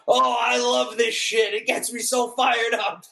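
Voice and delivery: excited high-pitched mock yell. Acting out a coach celebrating in hospital, high drawn-out yelling. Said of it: "he's just yelling".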